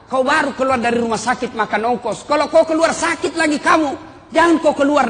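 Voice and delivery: lightly voiced mother forbidding her child; mildly hammed-up motherly scolding. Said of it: "Dilarang sama ibunya".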